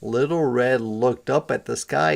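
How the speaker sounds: ordinary delivery, nothing marked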